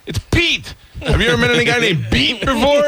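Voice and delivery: high-pitched exasperated rant; mock yelling. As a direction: imitate a man yelling at a caller. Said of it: "And he's yelling at him".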